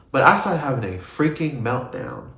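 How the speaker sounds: ordinary talk, nothing marked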